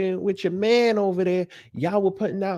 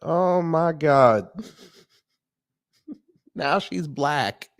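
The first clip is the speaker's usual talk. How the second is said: ordinary talk, nothing marked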